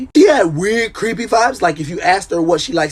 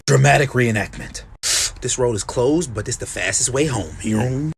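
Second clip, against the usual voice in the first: normal talking voice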